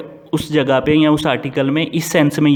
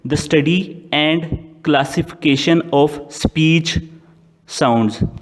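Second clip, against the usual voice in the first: very slow, word by word, as if dictating; slow dictation; much slower than usual. Shown as a demonstration. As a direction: spell out each word for students to write. Dictating a definition slowly. (धीरे-धीरे लिखवाते हुए)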